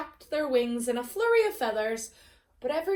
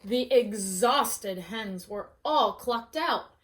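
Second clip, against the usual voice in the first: ordinary delivery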